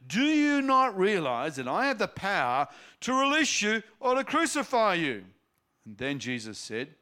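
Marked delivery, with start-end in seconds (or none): 0.1-5.2 high, loud demand. A narrator's reading. Pilate demanding an answer from Jesus, loud. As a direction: force an answer out of him. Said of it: "Pilate demanded".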